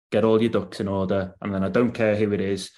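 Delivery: ordinary talk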